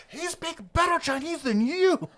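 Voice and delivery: put-on old woman scolding. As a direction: jab at the parent in a high old lady voice. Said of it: "She goes".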